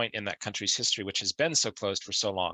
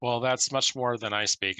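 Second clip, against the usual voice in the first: ordinary talk, nothing marked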